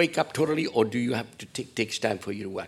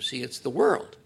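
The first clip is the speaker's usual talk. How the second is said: usual speaking voice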